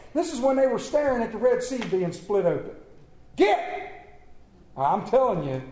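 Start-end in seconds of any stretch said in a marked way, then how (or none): 3.4-4.2 sharp command. Retold or imitated. God urging Israelites on.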